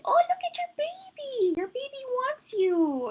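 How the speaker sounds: very high, then slow and sweet; sing-song baby talk. Retold or imitated. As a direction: coo like the baby is calling for them. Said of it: "I sound ridiculous when I'm doing sessions".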